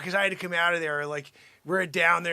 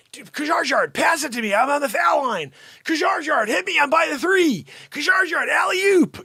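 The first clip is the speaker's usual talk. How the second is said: higher, louder calls to a teammate; calling for the ball; 8 semitones higher, 3 dB louder than usual. Pretend. Pretend player shouting to Kajgaard for an alley-oop, calling out. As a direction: yell across the court for the pass.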